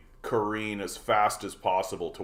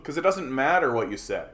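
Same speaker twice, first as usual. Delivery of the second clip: normal talking voice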